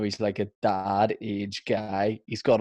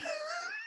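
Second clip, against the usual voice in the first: squealing laugh; 12 dB quieter than usual. Real, not acted. High squeal after joke.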